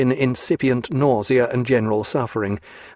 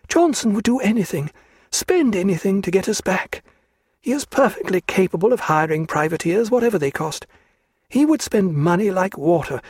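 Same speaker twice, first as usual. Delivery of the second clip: high, anxious woman's voice; Diana's voice; 7 semitones higher than usual. A narrator's reading. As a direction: speak up high, fearful of him. Diana's fearful outburst about Johnson.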